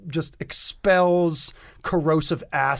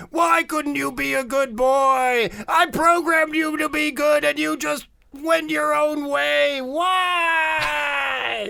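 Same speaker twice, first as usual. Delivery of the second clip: much higher, wailing, louder sobbing voice; blubbering; 12 semitones higher, 3 dB louder than usual. Acted. Alien mother wailing at her cyborg son, high blubbering. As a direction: wail it like a heartbroken mother. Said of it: "is blubbering, saying".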